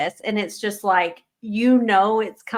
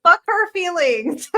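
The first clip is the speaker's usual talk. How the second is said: high-pitched, exasperated scolding; acted indignation; 7 semitones higher, 3 dB louder than usual. Reader telling off a beloved character, indignant. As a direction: scold the character in mock fury. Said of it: "I'm so fucking mad at you".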